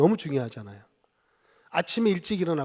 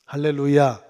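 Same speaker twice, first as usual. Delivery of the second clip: loud preacher's call, falling at the end; called out hallelujah; 9 dB louder, slower than usual. Real, not acted. Pastor calling the church to answer, loud hallelujah. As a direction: cry out to the congregation. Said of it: "아멘 크게 하세요".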